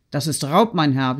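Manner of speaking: ordinary delivery, nothing marked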